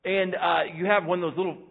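ordinary talk, nothing marked

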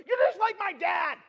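put-on high, loud teenage-girl outburst; angry shout. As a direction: imitate a girl yelling an accusation. Imitating angry girl snapping at him.

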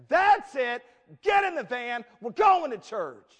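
very loud, high mock-furious shout; fed-up dad. Flustered dad ordering family into the van, fed-up shouting. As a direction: bellow orders, slowing for the last. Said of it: "the dad so piously says".

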